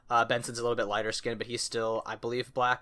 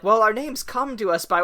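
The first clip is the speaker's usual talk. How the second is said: higher, louder, self-important raccoon; grand raccoon voice; 8 semitones higher, 7 dB louder than usual. Retold or imitated. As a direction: announce it like a proud tradition. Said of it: "they're like".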